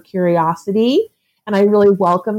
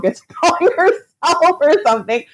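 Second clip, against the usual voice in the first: laughing; 9 semitones higher than usual. Real, not acted. Laughs at own self-label.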